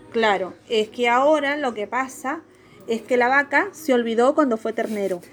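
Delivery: ordinary talk, nothing marked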